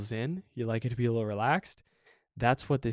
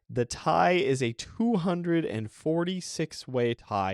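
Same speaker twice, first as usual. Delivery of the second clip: normal talking voice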